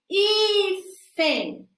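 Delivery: HÍ in hífen sung out high and drawn; hífen with its first syllable lifted and held; stressed syllable. Shown as a demonstration. Speech therapist teaching stress, hífen sung high. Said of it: "você vai dando uma entonação mais forte na pronúncia".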